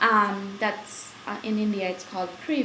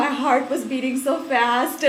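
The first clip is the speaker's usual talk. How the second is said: normal talking voice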